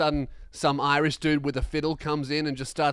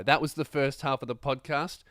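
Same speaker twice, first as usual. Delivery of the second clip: usual speaking voice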